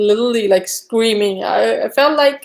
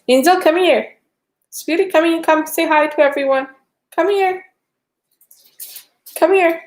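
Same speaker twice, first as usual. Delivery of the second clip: high sing-song coaxing call to a pet; calling the dog; 6 semitones higher than usual. Real, not acted. Calling her dog Angel to come to her, high coaxing. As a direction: coax the dog over, voice lifted and sweet.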